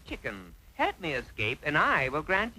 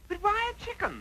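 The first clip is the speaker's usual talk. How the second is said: much higher pleading question; exasperated whine; 12 semitones higher, 3 dB quieter than usual. Acted. Lad pleading with the chicken, high and exasperated. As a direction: plead for an answer, fed up. Still insisting.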